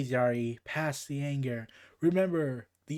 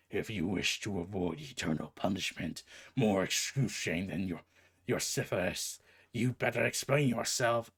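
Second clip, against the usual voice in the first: menacing Hades; 8 semitones lower than usual. Acted. Hades threatens Wonder Woman.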